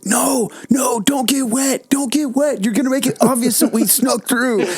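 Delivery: squealed, high-pitched imitation of alarm; high-pitched mock protest. Retelling the pit crowd's objections to friends, high mock protest. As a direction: mimic their fuss way up high.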